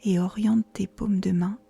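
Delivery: calm yoga nidra guidance, long pauses between phrases; unhurried, soothing relaxation-guide delivery; guided meditation. Acted. Guiding a listener into yoga nidra, calm meditation voice.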